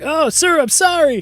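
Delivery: high, hammed-up apology to the boss; flustered apology. Underling apologizes after being hit.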